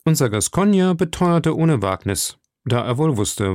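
ordinary delivery

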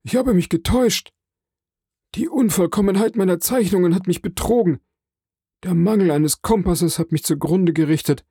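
high-pitched voice of a despairing fellow captive; high, dismayed confession of error; hidden prisoner, dismayed. A narrator's reading. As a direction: lament a ruinous miscalculation in a high voice.

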